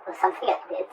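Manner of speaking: ordinary talk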